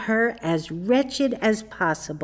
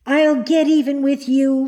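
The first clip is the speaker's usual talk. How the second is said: a louder, higher outburst of rage; furious cry; 5 semitones higher, 6 dB louder than usual. A narrator's reading. Marjorie turning on the teasing dream, crying out furiously. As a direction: hurl the threat at him in a passion. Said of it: "in a perfect passion of anger".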